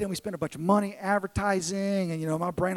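normal talking voice

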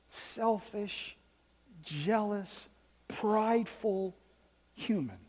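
God gently speaking to the pastor's heart; soft inner voice. Retold or imitated. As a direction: let it come like a whisper in the ear. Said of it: "God kinda whispered in my ear".

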